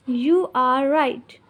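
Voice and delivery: slow, careful teacher model; slow model phrase. Shown as a demonstration. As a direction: say it slowly so they can copy. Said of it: "मुझे कहना है आप सही कह रहे हैं". (धीमे, साफ़ उच्चारण में)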